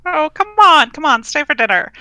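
loud, high, insistent invitation; coaxing. Acted. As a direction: talk her into staying. Coaxing sister to stay.

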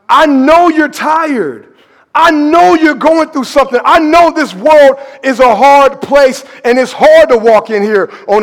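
preaching. Acted. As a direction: preach it with conviction. Preacher building to climax.

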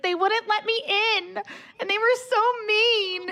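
high, slow, whiny mock sob; high, slow mock-tearful whine; mock crying girl. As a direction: whimper it like a sulking rioter. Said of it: "she's, like, crying".

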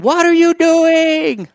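loud, very high, stretched-out wail; hammed-up frantic demand, dragged out; exasperated cry to God. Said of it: "have you ever asked God".

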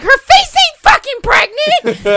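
very high, loud, drawn-out yell; screaming outrage. Retold or imitated. Retelling her comeback to 'she's pregnant', screaming outrage. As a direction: scream it slowly in sheer outrage. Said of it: "we're, like, screaming at each other".

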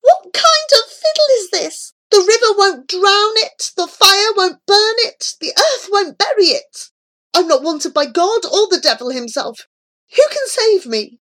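high, loud, desperate wailing; frantic high-pitched despair; anguished shriek. A narrator's reading. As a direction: cry out with your whole being. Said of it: "shrieked Remus, with his whole being".

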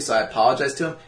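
ordinary talk, nothing marked

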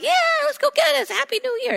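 high, excited party cheer; mock hype. Congregant mocking the pastor, high excited cheer. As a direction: cheer like someone at a new year party. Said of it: "We should be like".

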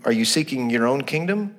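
normal talking voice

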